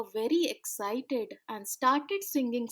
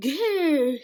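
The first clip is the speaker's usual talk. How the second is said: growling roar; 3 semitones higher, 7 dB louder than usual. Acted. Sea guardian roaring at the children, loud growl. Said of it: "he roared".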